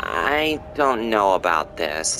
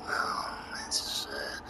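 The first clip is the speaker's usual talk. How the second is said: whisper; 10 semitones lower, 16 dB quieter, much slower than usual. Real, not acted. Checking script aside.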